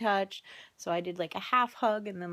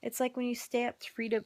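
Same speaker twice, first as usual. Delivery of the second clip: usual speaking voice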